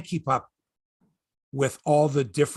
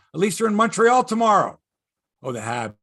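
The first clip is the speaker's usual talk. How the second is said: ordinary talk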